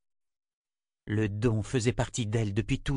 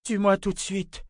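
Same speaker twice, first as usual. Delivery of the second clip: much higher, anguished plea; desperate cry; 10 semitones higher than usual. Acted. Nietzsche begging her torturer, a high anguished cry. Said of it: "cria-t-elle".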